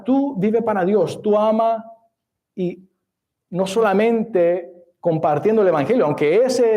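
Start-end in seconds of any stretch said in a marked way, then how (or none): none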